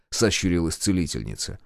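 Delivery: ordinary delivery, nothing marked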